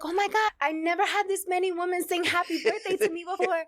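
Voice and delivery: high mock dad, shouting the thanks; imitating dad. Daughter mimicking dad thanking the crowd, high shout. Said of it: "And he was like".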